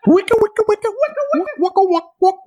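high-pitched mock record scratching; DJ scratch sound. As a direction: scratch it out like a DJ at the decks. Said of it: "I don't know how to do that".

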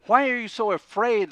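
high, loud "Why are you so afraid?"; exasperated shout. Retold or imitated. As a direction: hurl it in frustration. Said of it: "I put myself in there and I said".

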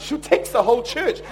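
ordinary talk, nothing marked

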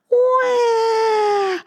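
put-on high, piercing baby cry; baby wail. Baby mouse wails in the dark, high piercing cry. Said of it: "cried Baby Mouse".

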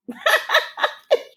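a sudden high laugh of disbelief; high breathy laugh. Real, not acted. Laughing in disbelief at her own DNA result, high and airy.

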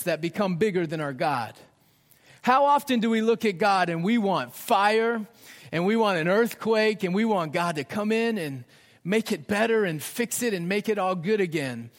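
usual speaking voice

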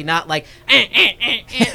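loud rhythmic mock grunts; grunting sound effect. Shown as a demonstration. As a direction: thrust out short grunts. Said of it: "Great sound effect".

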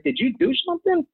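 worried colleague asking, pitched high; alarmed question. Retold or imitated. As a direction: ask it like the sky is falling. Coworker voiced in story.

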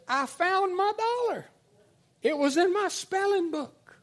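very high, loud, drawn-out announcement; hammed-up pupil crying out news; announcing schoolgirl. Classmate announces find, acted.

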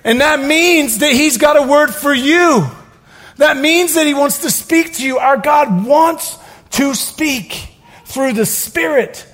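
rising fervent sermon peak; preaching at full force. Real, not acted. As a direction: preach it out to the room.

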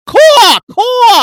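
very high, loud bird squawk; loud crow caw. Pretend. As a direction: give a full-throated caw. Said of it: "Really hit it, [name]".

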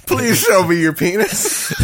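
giggling through the words; laughing. Pretend. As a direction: laugh while begging. Laughing mock plea.

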